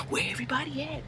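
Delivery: very soft mock whisper; hushed, furtive impersonation; imitated whisper. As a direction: whisper it like sneaking around alone.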